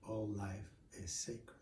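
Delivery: serene and soft. Acted. The gentle son's calm reply.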